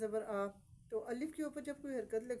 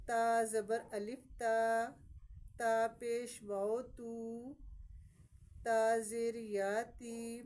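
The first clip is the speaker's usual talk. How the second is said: slow spelled-out reading; much slower than usual. Shown as a demonstration. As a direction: break the syllable apart slowly for a child. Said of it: "ہم اس کو توڑ کر کے پڑھاتے ہیں".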